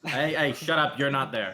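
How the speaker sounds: sharp shushing. Real, not acted. Game master shuts up a player whose character is absent, scolding. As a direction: cut the interrupter off loudly.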